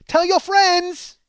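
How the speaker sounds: high, loud, drawn-out mock parlor woman; stretched, piercing send-off; called-out farewell. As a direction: holler it after a departing customer, imitating. Woman calls out as he leaves.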